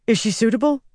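a little winded, high-pitched; slightly out of breath. Acted. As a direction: ask while catching breath, a bit breathless. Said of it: "sounding slightly out of breath".

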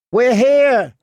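put-on high, loud little girl's cry; girl shouting toward the castle in fear; scared shout. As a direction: yell it out, thin and scared. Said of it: "she shouted".

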